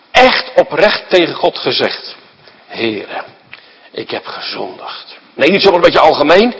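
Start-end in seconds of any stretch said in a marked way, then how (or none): 2.7-5.2 soft, low prayer of guilt; hushed confession. Acted. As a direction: confess it quietly to God.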